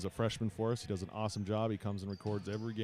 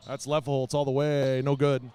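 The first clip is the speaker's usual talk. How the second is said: loud, raised-pitch shot call; live game announcer, higher and louder; excited play-by-play; 4 semitones higher, 8 dB louder than usual. Real, not acted. Calling a live shot attempt.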